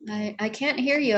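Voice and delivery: normal talking voice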